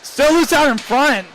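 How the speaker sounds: rising, slow excited call; excited play call. Real, not acted. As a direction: call the loose puck with urgency. Live call of loose puck.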